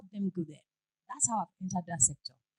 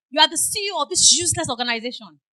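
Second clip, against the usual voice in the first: loud, higher accusing caller, imitated; angry caller; 5 semitones higher, 12 dB louder than usual. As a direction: hurl the insult like a furious customer.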